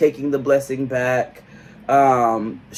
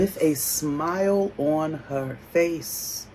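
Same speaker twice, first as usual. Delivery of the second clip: drawn-out, softer echo; slow emphasis; 9 dB quieter, much slower than usual. Real, not acted. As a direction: stretch each word slowly and knowingly. Stressing the smiling put-down.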